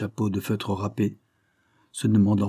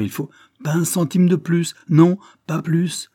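higher and louder, slowing into a firm no; proud refusal; 8 semitones higher, 6 dB louder than usual. Acted. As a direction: cut him off, refusing hotly. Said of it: "interrompit Wilfrid, en rougissant".

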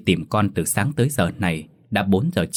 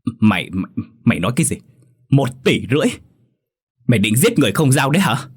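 stammering, then high loud disbelief; panicked stammer; 4 semitones higher, 4 dB louder, faster than usual. A narrator's reading. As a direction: stumble over the words in shock. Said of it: "hốt hoảng lắp bắp".

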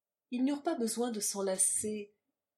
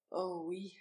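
subdued, mournful moan; 4 dB quieter than usual. A narrator's reading. Woman brought back to reality, mild moan. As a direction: let it out as a small moan. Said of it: "gémit-elle".

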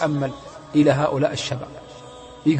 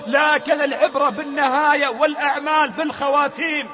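impassioned sermon, raised voice; fiery preaching; 10 semitones higher than usual. Acted. The preacher warns the youth, impassioned. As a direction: preach hard at the young.

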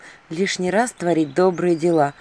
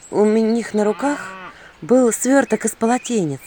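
usual speaking voice

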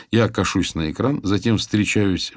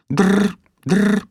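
phone ring; 14 semitones higher, 6 dB louder than usual. Retold or imitated. Imitating phone ringing.

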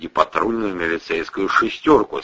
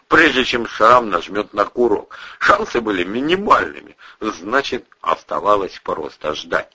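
ordinary delivery

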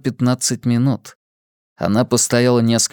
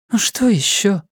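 weary annoyance; 8 semitones higher than usual. A narrator's reading. Weary woman snaps back.

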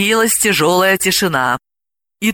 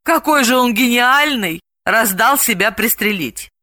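higher start, falling sceptical remark; doubtful; 6 semitones higher than usual. A narrator's reading. Delila doubts the man's genius, sceptical. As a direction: dismiss it with doubt. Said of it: "усомнилась Далила".

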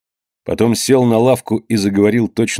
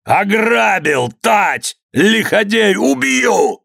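high-pitched, drawn-out shouts of rage; frenzied scream; 11 semitones higher, much slower than usual. A narrator's reading. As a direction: scream like a madman. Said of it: "кричал, как бешеный". (бешеный крик)

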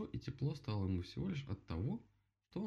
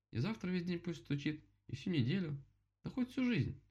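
rising higher to the end; building excitement; 10 semitones higher than usual. Acted. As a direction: get more and more eager. Bear wishes endless knocking.

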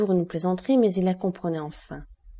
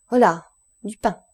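put-on louder call to the boy; 5 dB louder than usual. A narrator's reading. Peppino calls to the boy for bread, shouting. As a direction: shout the order down the cave. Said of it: "cria-t-il".

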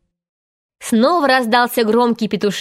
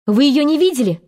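higher, anxious question; growing worried; 5 semitones higher, faster than usual. A narrator's reading. Peter asking after Wendy, beginning to worry. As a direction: ask with mounting unease. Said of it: "начиная беспокоиться".

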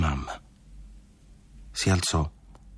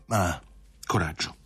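ordinary delivery

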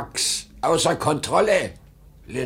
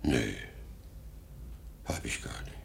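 bewildered whisper; 3 semitones lower, 12 dB quieter than usual. Acted. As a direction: wonder aloud, barely audible. Husband puzzled, trailing off.

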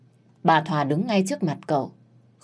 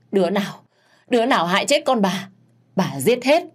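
higher, louder furious threat; raging scream; 4 semitones higher, 4 dB louder than usual. Acted. Grieving mother rages at the unknown killer, furious scream. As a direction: shriek vengeance at whoever did it. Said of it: "Miệng thì gào thét".

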